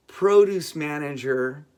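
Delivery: ordinary delivery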